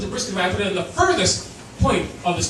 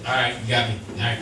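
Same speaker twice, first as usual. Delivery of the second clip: low, resigned dog voice admitting guilt; a lower voice for the caught dog, sighing it out; guilty dog; 12 semitones lower, 3 dB quieter, faster than usual. Retold or imitated. The caught dog owning up to his owner, low and resigned.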